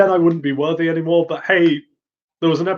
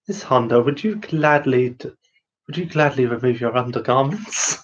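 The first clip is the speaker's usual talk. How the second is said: pervy Yuki Soma voice. Retold or imitated. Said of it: "the only reason I do this voice is to get a bit pervy with it".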